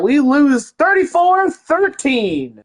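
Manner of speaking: high, slow, drawn-out score call; announcer reveal. Acted. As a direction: stretch out the final score like an announcer. Announces the playoff result.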